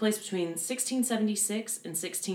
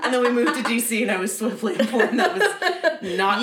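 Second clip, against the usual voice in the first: loud, high telling through laughter; laughing; 6 semitones higher, 9 dB louder than usual. Real, not acted. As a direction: confess it while cracking up. Laughing over own mispronunciation.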